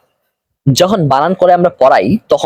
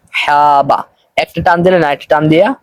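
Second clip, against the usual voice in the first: ordinary talk, nothing marked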